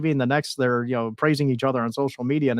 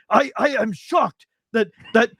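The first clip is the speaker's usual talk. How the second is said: a hint of Russian accent, raised pitch, indignant; mock Russian accent; 8 semitones higher than usual. Ivan scolding his nephew Nikita, light mock Russian accent. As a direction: lightly voice an offended Russian uncle.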